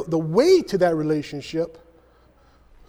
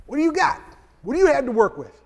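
higher, urgent asking; pressing question; 7 semitones higher, faster than usual. Retold or imitated. Preacher voicing Elisha pressing the widow. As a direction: push her to look at what she has.

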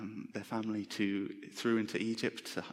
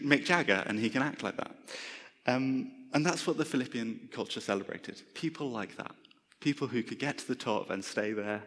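ordinary talk, nothing marked

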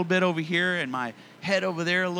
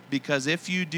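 ordinary talk, nothing marked

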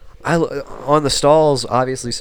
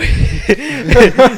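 burst of laughter; 13 semitones higher, 9 dB louder than usual. Real, not acted. Laughing after the mock yell.

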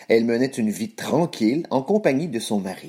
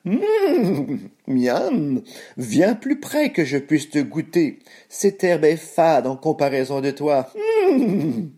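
whinnying horse voice, higher; hungry horse; 5 semitones higher, slower than usual. A narrator's reading. Horse invites the cookie closer for a taste, whinnying. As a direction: neigh around the words, savouring them. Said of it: "fit le cheval".

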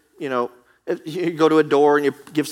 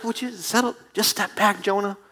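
calming God; 6 semitones higher than usual. Acted. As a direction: talk an angry friend down. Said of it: "And God's like".

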